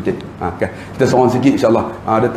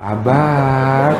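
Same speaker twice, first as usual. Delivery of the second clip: drawn-out, coaxing address; gentle low tone. Acted. Wife answering an angry husband, gentle drawn-out coaxing. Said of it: "nada rendah".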